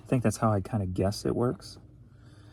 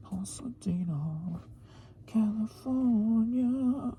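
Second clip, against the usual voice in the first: breathy drawn-out "Pasadena, California"; whispered slow Pasadena; 9 semitones higher, 4 dB quieter, much slower than usual. Pretend. Vlogger savors the city name, whispered and drawn out. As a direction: whisper "Pasadena" slowly, then stretch "California" long.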